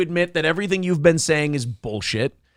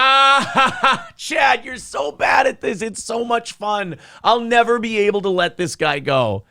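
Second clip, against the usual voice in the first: delighted jeering out of a laugh; gleeful mocking; 6 semitones higher than usual. Real, not acted. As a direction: crow with delight, voice pitched way up. Gleeful taunting at clip response.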